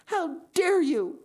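very high, loud and slow indignation; girl furious at the boy who teased her; outraged cry. A narrator's reading. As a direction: spit out each word in fury. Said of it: "she exclaimed passionately".

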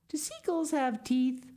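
put-on high, curious child's voice; little girl wondering. A narrator's reading. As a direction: ask like a curious small girl. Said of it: "wondered Sal".

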